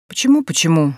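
ordinary delivery

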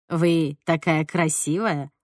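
slow, low, pleading compliment; plaintive older man. A narrator's reading. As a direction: speak haltingly, as in a hard foreign language. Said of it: "жалобно повторил дяденька на английском".

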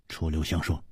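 usual speaking voice

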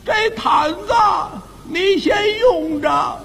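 put-on high, very slow, quavering elderly voice; trembling old man. Old neighbour offering his blanket in the cold, tremulous and slow. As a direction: draw each phrase out shakily, pausing like a frail old man. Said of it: "这老头儿哆了哆嗦， 颤颤巍巍".

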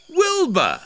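high, loud cry of surprise; startled, high-pitched outburst; astonished gasp. A narrator's reading. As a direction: exclaim the name in shock. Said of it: "gasped Elmer".

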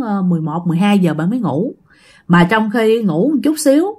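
usual speaking voice

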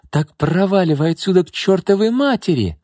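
high, shouted furious order; furious aunt. A narrator's reading. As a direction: drive her out into the rain. Aunt throws her out. (яростно выгоняя)

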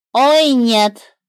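the bird refusing to play; flustered bird. A narrator's reading. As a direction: flap it off like a startled bird. Bird's voice.

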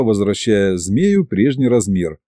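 usual speaking voice

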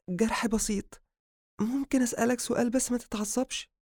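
high, tentative woman; high-pitched female character; female doctor's voice. Acted. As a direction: ask carefully in a high female voice. Woman asks cautiously.

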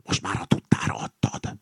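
soft, voiceless whisper; whispered reproach to an accomplice. A narrator's reading. (halk, szemrehányó suttogás)

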